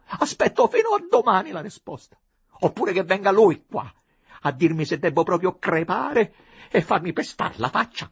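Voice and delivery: choked furious demand. A narrator's reading. Abandoned woman demands an answer, choked with tears and fury. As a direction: she has been crying and now she rages. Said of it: "soffocata e con gli occhi tumidi e insanguinati dal pianto".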